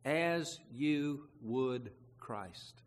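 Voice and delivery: each word drawn out slowly and weighed; slow right down and lean on "As you would Christ"; slow emphatic reading. Shown as a demonstration. Preacher to congregation, slow and weighty. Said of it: "Watch this".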